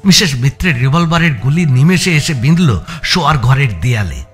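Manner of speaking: ordinary talk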